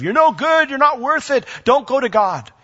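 high, loud taunting voice of the enemy; accusing enemy voice. Acted. The enemy taunting sinners, high loud accusing voice. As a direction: sneer the lies like a tormentor. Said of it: "That's what the enemy convinces us of".

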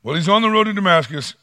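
ordinary talk

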